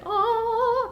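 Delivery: high, held opera wail; operatic "Ah". Shown as a demonstration. Streamer imitating the game's shouty song, operatic wail. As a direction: belt a mock-opera note, far above speaking pitch. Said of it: "the operatic".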